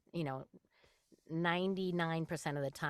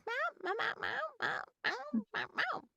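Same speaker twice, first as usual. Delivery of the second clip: very high little meows; talking cat; faster than usual. Retold or imitated. Nine-year-old cat answers his owner, high imitated meowing. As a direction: imitate a cat trying to talk. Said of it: "he tries to talk ... he's like".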